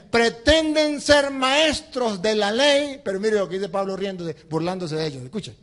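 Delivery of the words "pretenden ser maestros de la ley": mocking. Shown as a demonstration. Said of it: "mocking the self-styled Bible experts".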